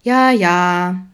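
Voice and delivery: loud, drawn-out yeah yeah; breezy child's dismissal; dismissive child. A narrator's reading. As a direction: brush Dad off in a singsong. Charlie brushes off father's advice.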